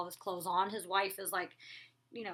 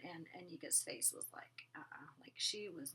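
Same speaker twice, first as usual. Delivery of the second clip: soft unvoiced whisper; hushed gossipy aside; 5 semitones lower, 14 dB quieter than usual. Real, not acted. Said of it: "whispered aside about Anika".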